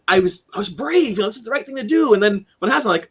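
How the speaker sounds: ordinary delivery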